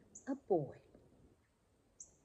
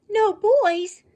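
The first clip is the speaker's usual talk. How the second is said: put-on No boys? cried out much higher and louder in shock; dismayed cry; 26 dB louder than usual. Puppy hears there are no boys, high shocked cry. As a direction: let the puppy's heart sink in a high cry. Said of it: "cried Petey".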